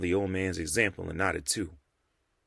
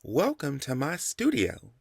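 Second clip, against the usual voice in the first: put-on slow, higher welcome with a light Chinese accent; slight Chinese accent; 6 semitones higher, slower than usual. A narrator's reading. Artist Mei welcomes the men to her studio, slight Chinese accent. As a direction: greet guests gently, a hint of Chinese accent. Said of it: "she said with a slight accent".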